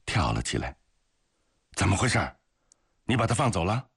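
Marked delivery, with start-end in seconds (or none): 1.7-3.9 higher-pitched, taken aback; startled questioning. A narrator's reading. A comrade jumps up as the guard returns alone, startled. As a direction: demand an answer in startled surprise. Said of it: "夏氏大吃一惊".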